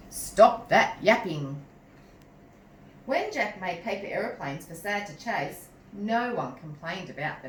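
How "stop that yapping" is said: slow, louder stern boom; recalling Mr Cripps booming at the dog; mock boom. A narrator's reading. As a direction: boom it out like the old owner. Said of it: "No one boomed".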